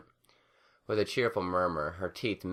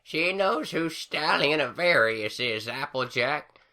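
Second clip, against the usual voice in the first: put-on beaming old granny; 9 semitones higher, 4 dB louder, slower than usual. A narrator's reading. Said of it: "Granny Smith beamed".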